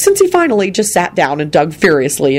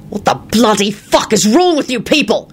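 higher, enraged outburst; screamed in rage; 6 semitones higher than usual. A narrator's reading. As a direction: explode at the whole table. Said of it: "he screamed".

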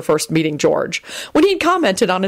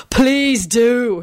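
higher, drawn-out scornful taunt; 6 semitones higher than usual. A narrator's reading. George daring Quentin to attack, scornful. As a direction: dare him with mock eagerness. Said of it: "he said scornfully".

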